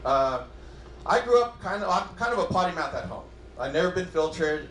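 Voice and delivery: ordinary delivery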